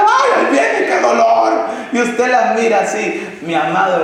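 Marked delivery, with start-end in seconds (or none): 0.0-1.7 mother in labour crying out, imitated shriek. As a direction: squeal it like a woman pushing. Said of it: "Y están gritando".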